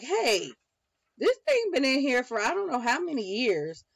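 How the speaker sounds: high, loud indignant discovery; acting out a startled find; surprised outburst. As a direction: exclaim it, voice jumping up loud. Acting out discovery in her cart.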